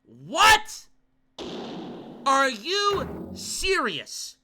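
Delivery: high, loud stunned protest; incredulous, exasperated outcry; outraged disbelief. Real, not acted. Outraged disbelief at game outcome.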